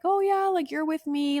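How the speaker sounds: high-pitched, friendly welcome; super nice counselor. Retold or imitated. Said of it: "super nice".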